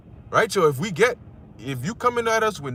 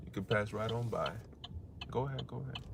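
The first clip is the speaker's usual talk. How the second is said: much softer, low, waving it off; hushed dismissal; 7 semitones lower, 16 dB quieter than usual. Acted. Preacher dismissing riches, low hushed voice. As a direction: let it slide past, barely voiced.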